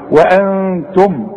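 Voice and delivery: وانتم slow with the نون hidden and nasalized; nasal ghunna held. Shown as a demonstration. Teacher modelling the hidden noon for the student. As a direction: hold the ghunna in وانتم slowly. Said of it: "اوعى تقول لي تنظرون".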